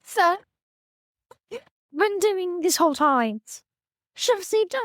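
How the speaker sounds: high-pitched attempt at a British accent. Player mimicking a halfling woman for the game master, high mock British. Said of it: "So high-pitched British, is that what".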